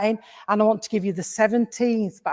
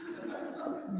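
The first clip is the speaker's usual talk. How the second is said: soft breathy laugh; 17 dB quieter than usual. Real, not acted. Medium laughs at own remark.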